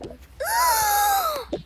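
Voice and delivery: huge gasp jumping way up in pitch; squealing intake of breath; thrilled high gasp. Acted. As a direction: gasp like you just heard the best news. Child thrilled by invitation.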